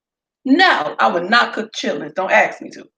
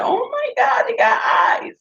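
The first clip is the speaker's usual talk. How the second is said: much higher, breaking into a crying yelp; mock horrified shriek. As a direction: squeal like a grossed-out eight-year-old. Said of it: "I'm already like".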